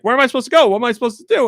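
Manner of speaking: high, loud lost whine; confused whine. Retold or imitated. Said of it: "they'd be like".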